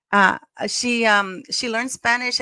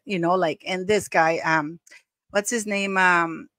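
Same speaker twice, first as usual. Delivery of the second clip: ordinary talk, nothing marked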